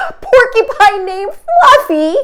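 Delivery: high, laughing rhino voice; high-pitched laughter-filled howl. Acted. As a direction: laugh the name out in disbelief. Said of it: "howled the rhinoceros".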